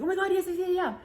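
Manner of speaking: high, squealing yes yes; eager agreement. Retold or imitated. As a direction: gush with excitement.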